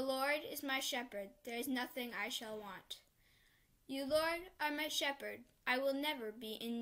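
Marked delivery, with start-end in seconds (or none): none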